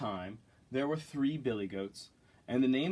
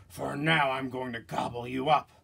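mean troll threat, a little louder; 5 dB louder than usual. Acted. Troll threatening to eat the little goat, menacing voice. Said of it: "said the troll".

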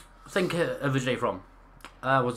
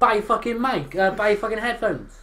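exasperated shout; 10 semitones higher, 7 dB louder than usual. Real, not acted. Exasperated demand to friend.

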